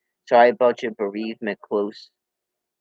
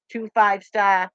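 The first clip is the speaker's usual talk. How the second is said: normal talking voice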